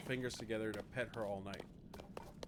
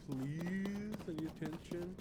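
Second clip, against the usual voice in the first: cat voice; 7 semitones higher, 3 dB quieter, slower than usual. Retold or imitated. Cat voiced asking for attention.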